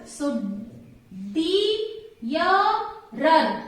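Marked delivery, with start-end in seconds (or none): 1.3-3.6 three separate beats with gaps between; syllable by syllable. Shown as a demonstration. Teacher letting students count syllables, each one set apart. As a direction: sound out each syllable on its own. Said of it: "Three syllables".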